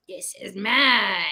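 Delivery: slow, kiddie-lesson anger; childish mad. Retold or imitated. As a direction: label the anger like a little kid. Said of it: "like we learned emotions when we were little".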